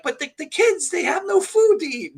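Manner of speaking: high mock wife voice; pleading wife. Wife pleading with wrestler husband about kids, high mock voice. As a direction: whine it up high like the worried wife. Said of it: "then she's like".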